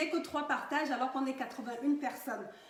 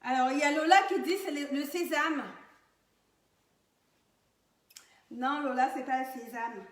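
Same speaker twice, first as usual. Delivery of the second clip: to someone hard of hearing; 7 dB louder than usual. Real, not acted. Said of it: "il faut que je parle plus fort".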